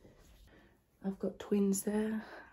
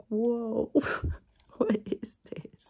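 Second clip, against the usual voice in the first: retold surprise, louder, laughing; amazed whoa; 3 semitones higher, 6 dB louder, much slower than usual. Telling viewers about the odd device, with loud laughing surprise. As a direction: recall finding it, wide-eyed. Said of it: "I was like".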